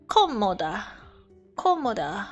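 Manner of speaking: ordinary talk, nothing marked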